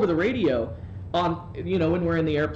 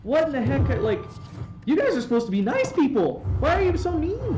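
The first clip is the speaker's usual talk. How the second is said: whiny disbelief; 8 semitones higher than usual. Retold or imitated. Retold complaint to airline crew.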